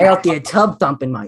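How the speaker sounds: thick mock Australian accent; Australian steelworker. Steel mill worker off to the pub, Australian accent. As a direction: play an Australian guy just off shift. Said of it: "it's like a Australian guy".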